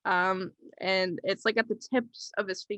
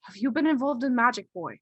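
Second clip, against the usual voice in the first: probing doctor, slightly raised pitch, brisk pace; in-character doctor; 4 semitones higher, 5 dB louder than usual. Acted. Doctor to the boy after listening to his heart, probing. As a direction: question the boy quickly, a bit higher. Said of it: "and he says".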